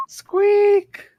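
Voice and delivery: tiny high squeak; rabbit squeak. Pretend. Voicing the rabbit familiar.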